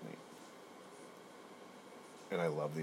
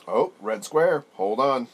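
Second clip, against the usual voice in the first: loud, higher startled outburst; sudden alarm; 9 semitones higher, 17 dB louder, slower than usual. Real, not acted. Interrupted while recording for girlfriend, startled exclamation. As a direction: jolt as something interrupts.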